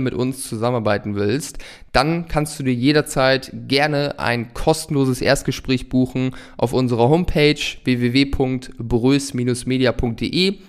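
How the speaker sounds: ordinary talk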